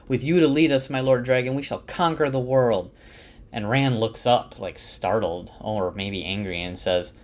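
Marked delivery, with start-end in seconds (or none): 0.1-2.9 loud, high fervent yell. Retold or imitated. A follower yells to the Lord Dragon, loud and high. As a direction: cheer him on at the top of your voice. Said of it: "One person yells".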